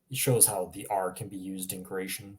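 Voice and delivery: ordinary talk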